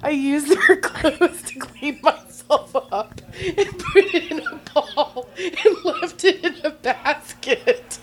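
high, slow words broken by laughter; can barely get it out for laughing; laughing through it. Real, not acted. Woman confessing her dressing-room accident while laughing helplessly.

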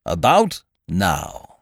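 slow, self-satisfied drawl; complacent. A narrator's reading. Ukridge gloats about his aunt at sea, slow and complacent. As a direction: savour the thought smugly. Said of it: "he said complacently".

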